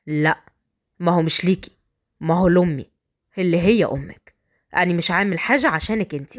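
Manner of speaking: low, cool rebuttal; husband putting her down; dismissive bridegroom. Acted. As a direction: set her straight in a deep male voice.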